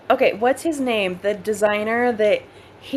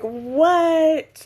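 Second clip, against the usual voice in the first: high, loud mock-shocked outburst; exaggerated astonishment; retold disbelief; 10 semitones higher, 5 dB louder than usual. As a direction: stretch it out, baffled and high. Retold shocked reaction to cousins' accents.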